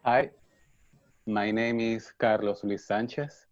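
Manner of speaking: normal talking voice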